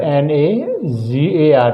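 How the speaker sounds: letters spelled out slowly; slow spelling. Shown as a demonstration. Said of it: "teacher spelling the word out". (धीरे-धीरे अक्षर बोलना)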